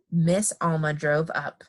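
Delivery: ordinary delivery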